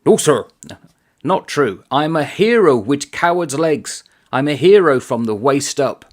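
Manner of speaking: put-on soldier's defence, no accent. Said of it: "I'm not gonna do the Irish accent".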